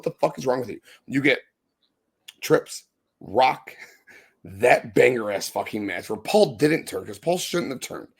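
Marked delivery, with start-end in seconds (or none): none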